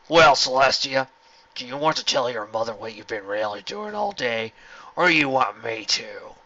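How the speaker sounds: slow smug taunt; taunting general. A narrator's reading. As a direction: bait her slowly.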